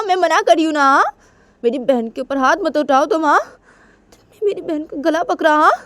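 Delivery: protective scolding. Retold or imitated. Anaya scolding Tisha for grabbing her sister, indignant. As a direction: defend the little sister firmly. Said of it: "Anaya Tisha को बोलती है".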